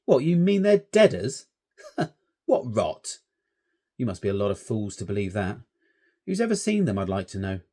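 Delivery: mock the shepherd's ghosts; Stanley scoffing at the ghost story; scornful and bitter. A narrator's reading. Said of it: "Stanley was scornful and bitter".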